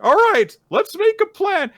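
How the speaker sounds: high, loud burst of resolve; acted gung-ho enthusiasm; eager rallying. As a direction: launch into it like a keen young hero. Eager suitor starts planning.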